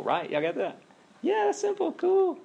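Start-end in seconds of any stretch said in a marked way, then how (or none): 1.2-2.4 very high, put-on falsetto; mock agreement. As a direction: squeak out fake easy agreement for a laugh. Jokingly voices audience claiming it's simple.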